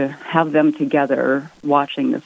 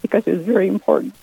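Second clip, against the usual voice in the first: teary-eyed; 3 semitones higher, faster than usual. Real, not acted. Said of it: "I get teary-eyed when I look at it".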